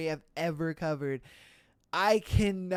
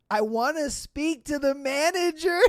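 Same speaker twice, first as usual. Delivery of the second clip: higher-pitched entitled complaint; lightly voiced indignant customer, about to crack up; imitated demand for service; mock demanding customer; 7 semitones higher than usual. Voices complaining woman, then laughs.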